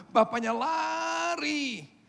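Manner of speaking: real tears rising as he tells it; tearful. Real, not acted. Preacher moved to tears telling the father running, crying. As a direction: voice breaking into a cry, high.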